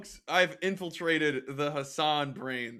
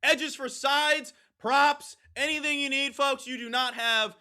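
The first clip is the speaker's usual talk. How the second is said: ad read; 10 semitones higher, 4 dB louder, slower than usual. Acted. Ad read for sponsor.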